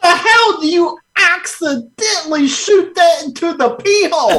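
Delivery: high, outraged squeal; can't believe what you heard; shocked disbelief. Real, not acted.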